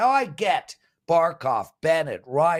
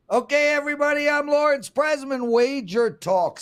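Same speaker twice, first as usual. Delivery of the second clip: hammed-up presidential greeting to the viewers; mock presidential address; 10 semitones higher than usual. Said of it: "a daily presidential address".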